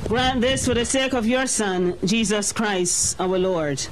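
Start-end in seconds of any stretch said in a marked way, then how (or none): none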